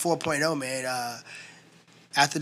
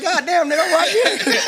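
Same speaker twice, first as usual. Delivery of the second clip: much higher, louder, laughing protest; mock indignation; 13 semitones higher, 6 dB louder than usual. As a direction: play up the outrage at being mocked. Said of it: "I'm like".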